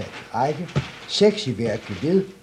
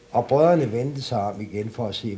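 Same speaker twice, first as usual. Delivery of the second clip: ordinary delivery, nothing marked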